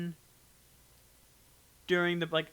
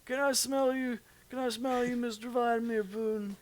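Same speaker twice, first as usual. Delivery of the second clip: higher creepy sniffing voice; 6 semitones higher than usual. Pretend. Joking to Putin, higher hammed-up creepy voice. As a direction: ham up a creepy admirer.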